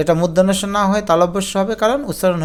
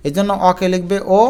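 ordinary talk, nothing marked

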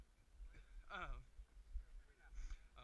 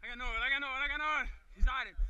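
loud call across the field; shouting; 3 semitones higher, 12 dB louder, faster than usual. Real, not acted. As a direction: yell it out to teammates. Calling out coverage on field.

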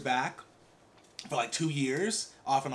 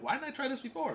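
surprised inner voice; 10 semitones higher, 4 dB quieter than usual. Retold or imitated. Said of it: "I'm like".

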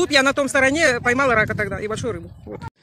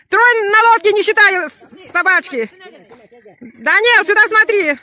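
calling out; 6 semitones higher, 5 dB louder than usual. Real, not acted. Calling to people on boat.

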